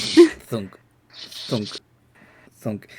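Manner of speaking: three mock arrow thunks, fading; arrow thunk sounds. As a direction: voice each arrow hit as a dull thunk. Arrow hit sound effect.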